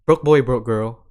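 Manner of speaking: loud, slow call-out to the audience. Real, not acted. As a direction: shout it out to every broke listener. Calling out broke listeners.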